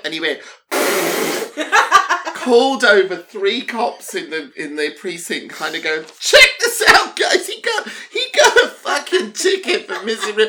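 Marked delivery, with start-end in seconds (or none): 6.2-10.4 high, loud, laughing mockery; mocking laughing cop. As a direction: crow about it to the other cops. Cop mocking him to colleagues.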